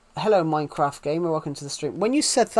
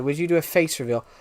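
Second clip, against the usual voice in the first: normal talking voice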